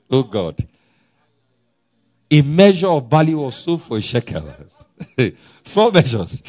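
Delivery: ordinary talk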